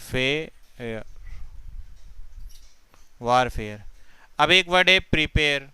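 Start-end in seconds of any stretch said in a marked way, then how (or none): 0.0-1.0 fair stretched out very slowly; slow demo. Shown as a demonstration. Teacher isolating fair for students, very slow. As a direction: draw out fair so every sound is heard.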